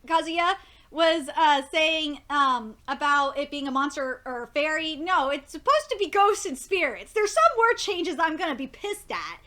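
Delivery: ordinary talk, nothing marked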